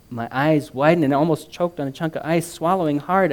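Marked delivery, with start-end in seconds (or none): none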